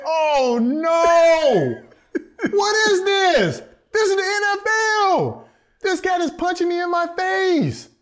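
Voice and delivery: high, loud, laughing panic; panicked rookie. Retold or imitated. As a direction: plead with the ref in disbelief.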